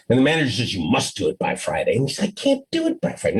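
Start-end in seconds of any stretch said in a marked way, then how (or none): 0.7-2.0 much lower, firm demanding boss; stern manager. Acted. Manager insists on the deadline. 2.3-3.4 much higher, quick pleading refusal; second, pleading refusal. Acted. Employee refuses again under pressure.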